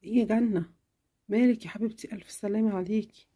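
mother answering her sick daughter, tenderly; caring mother. Acted. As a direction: soothe your little girl. Mother comforts sick child.

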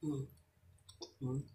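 ordinary talk

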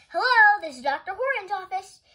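high, loud receptionist greeting; bright phone greeting. A narrator's reading. Amelia Bedelia answers the doctor's telephone, loudly and eagerly.

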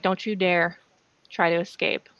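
ordinary talk

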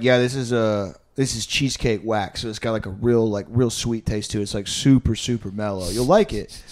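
mock dealer voice lowering pitch; friend's voice. Friend pitching wax, voiced low.

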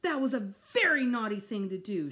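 loud scolding, key words hammered; mother really letting go; angry shout. A narrator's reading. Mother scolding son for hiding baby, angry shout. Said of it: "she shouted".

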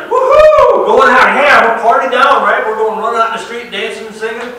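whooping, very high and very loud; party-shouting like a freed man; wild celebration. Retold or imitated. Forgiven servant celebrating his cancelled debt, whooping wildly. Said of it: "He's like".

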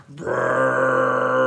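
low, drawn-out pretend belch; long fake burp. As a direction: fake a big giraffe burp. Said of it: "she should burp".

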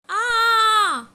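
high loud frightened scream; little fish shrieking at the shark. Acted. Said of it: "Sterling shrieked".

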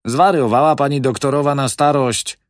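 loud, high angry outburst; angry snort. Acted. Manager scolding the old widow, loud and angry. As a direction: snap it out in irritation. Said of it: "parsknął nagle kierownik i gniewnie".

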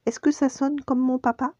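high, hopeful little cub voice; young Simba. A narrator's reading. Cub asking his friend about his roar, high child voice. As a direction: ask in a small high child's voice. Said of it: "rugit le plus fort possible".

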